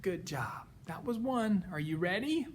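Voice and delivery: ordinary delivery, nothing marked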